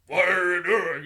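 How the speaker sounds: loud mock character voice; film character voice. Host imitating the actor voicing the Trade Federation, loud character voice. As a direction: belt it out as an actor voicing an alien. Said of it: "they have, like, him voice of".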